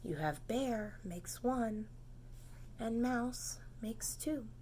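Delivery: soft whispered count. A narrator's reading. Counting friends with the children in a whisper. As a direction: breathe the count out quietly.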